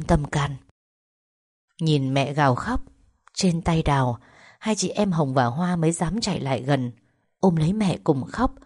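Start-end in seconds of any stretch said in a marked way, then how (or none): none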